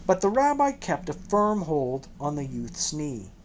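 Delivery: plain reading voice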